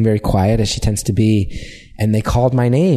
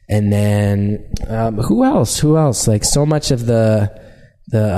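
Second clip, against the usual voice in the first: ordinary talk, nothing marked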